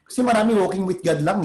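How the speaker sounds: ordinary talk, nothing marked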